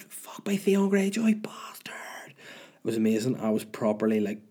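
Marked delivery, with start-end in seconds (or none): 0.0-1.9 slow raspy whispered villain growl; Game of Thrones voice. Pretend. Reliving Winterfell visit, doing a whispered Game of Thrones voice. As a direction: hiss it like a brooding northern lord. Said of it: "my Game of Thrones voice".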